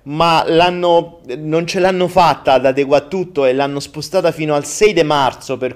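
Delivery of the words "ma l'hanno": ordinary talk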